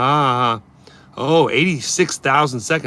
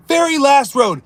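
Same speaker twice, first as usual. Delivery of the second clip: "Very last road" said higher with VERY punched; emphatic repeat; 8 semitones higher, 4 dB louder than usual. Acted. Streamer stressing where the brake checking began, emphatic. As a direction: lean hard on "Very".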